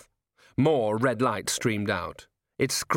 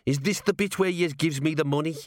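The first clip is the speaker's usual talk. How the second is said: higher, gruff little gnome talking shop; gnome rat-catcher voice; 5 semitones higher than usual. A narrator's reading. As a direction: play the tiny tough gnome, unbothered. Gnome Arthur asks for pay.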